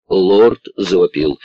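ordinary delivery

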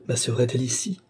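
usual speaking voice

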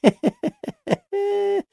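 high-pitched tittering giggle. A narrator's reading. Maksimov ends his story with a high giggle. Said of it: "Хихикнул, закончив Максимов".

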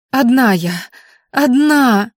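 high whimpering insistence. A narrator's reading. Girl whimpers to the gunman that she is alone. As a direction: whine it out, scared. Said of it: "проскулила девушка".

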